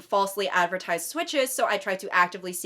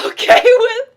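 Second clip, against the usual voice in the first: laughing; 13 semitones higher, 12 dB louder than usual. Real, not acted. Laughs on the line.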